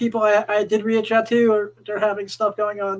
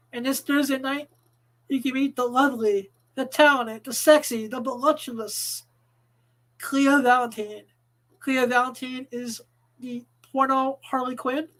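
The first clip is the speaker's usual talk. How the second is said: announcer ad read; 2 semitones higher than usual. Acted. Club ad read.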